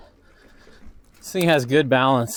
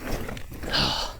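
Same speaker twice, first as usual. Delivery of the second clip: effort grunt; 9 dB quieter than usual. Real, not acted. Effort after bunny hop.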